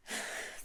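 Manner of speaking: relieved exhale; soft acted sigh as danger passes; sigh of relief. A narrator's reading. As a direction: breathe out audibly in relief. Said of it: "breathes out a sigh of relief".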